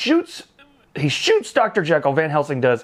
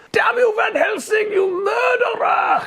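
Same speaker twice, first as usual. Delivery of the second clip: high, slow furious yell; cop's outraged shout; slower than usual. Retold or imitated. Cop shouting at Van Helsing on the cathedral, a high drawn-out yell. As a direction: drag out the accusation in a shout. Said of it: "he goes".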